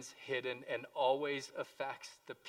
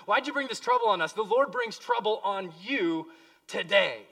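higher, louder, fast accusing rebuke; Joshua rebuking Achan; 4 semitones higher, 11 dB louder than usual. Acted. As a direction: confront Achan, hurling the question at him. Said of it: "He's talking to Achan".

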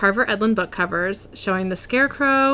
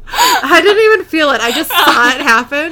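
laughing through the words; giggling helplessly while talking; 6 semitones higher, 7 dB louder than usual. Real, not acted. Laughing at own spit accident.

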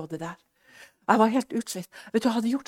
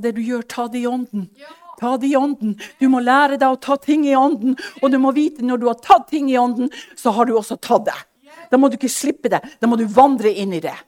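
loud, higher, preaching command; God's forceful answer; 5 semitones higher, 11 dB louder than usual. Retold or imitated. God telling Anie to take it in the spirit, loud preaching. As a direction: proclaim the Lord's instruction with force.

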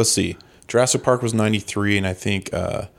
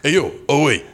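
a big mock New York greeting; Tony Danza impression; 5 semitones higher than usual. Friends summon Tony Danza as a bit, hammed-up tough-guy voice. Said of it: "dial up Tony Danza".

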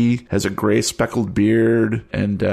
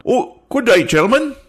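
high, loud jolly islander; high-pitched character voice; 11 semitones higher, 4 dB louder than usual. Acted. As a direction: play a cheerful odd local cop, high and bright. GM plays police NPC.

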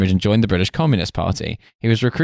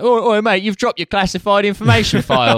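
high, bright mock Cockney barman hailing a mate; British pub landlord; 11 semitones higher than usual. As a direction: play a cheery pub landlord shouting across the bar. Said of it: "British pub landlord being like".

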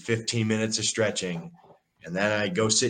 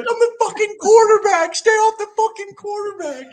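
shrieking much higher and louder, slowed; mock a squeaky screaming teammate; piercing high yell of outrage; high-pitched yell; 9 dB louder than usual. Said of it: "just high pitch".

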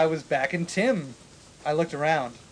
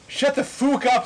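high, loud snapped retort; hammed-up rude shout; mock snap; 9 semitones higher, 4 dB louder than usual. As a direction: bark it at them in mock outrage. Reading a rude quote dramatically.

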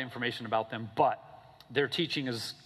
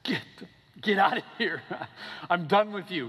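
angry Pharaoh; 6 semitones higher than usual. Retold or imitated. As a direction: throw them out like a fed-up king. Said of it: "Pharaoh says".

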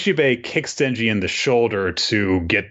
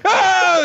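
high, loud yelp of panic; panicked scream; 5 dB louder than usual. Retold or imitated. Friend imitating Light Yagami's ending, panicked scream. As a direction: shriek like a cornered villain. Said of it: "where Light is just like".

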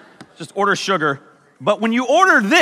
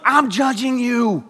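normal talking voice

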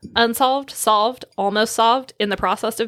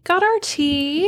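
higher, slow, sing-song delivery; playful mock drama; 9 semitones higher, slower than usual. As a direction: draw it out teasingly. Playful repeat about tea.

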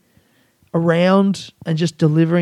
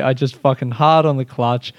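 ordinary talk

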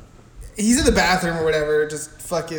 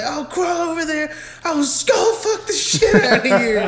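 squeaky, laughing mockery of the stepdad; high laughing parody of a crude stepdad; high mock drunk; 11 semitones higher, 4 dB louder than usual. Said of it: "he's like".

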